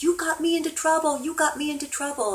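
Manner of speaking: high, loud accusing yell; retold yelling. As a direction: burst in yelling a complaint, pitch way up. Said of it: "comes into my office yelling at me".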